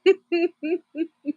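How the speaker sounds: high run of leftover giggles; real giggling. Real, not acted. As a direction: let the leftover laughter bubble out. Still amused by the banana video.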